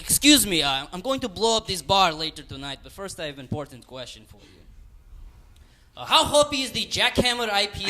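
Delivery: loud, hammed-up Russian accent; Russian terrorist accent. Terrorist questions bartender about beer in mock Russian accent. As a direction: play a bad stage Russian, projecting. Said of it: "this is a Russian terrorist that I'm gonna do".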